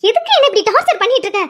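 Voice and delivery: high-pitched angry protest; exasperated. Acted. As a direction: burst out at the husband who keeps pestering. Wife fed up with husband's pleading. (எரிச்சலோடு உயர்ந்த குரலில் கோபக் கேள்வி)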